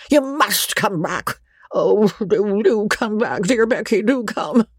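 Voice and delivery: put-on an old man pleading for her return; pleading old baronet. A narrator's reading.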